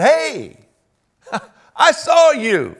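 high, loud, slow taunt with a laugh; gloating accuser. Acted. Malchus's relative catches Peter, a loud, laughing taunt.

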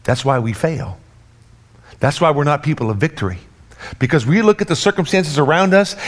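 ordinary talk, nothing marked

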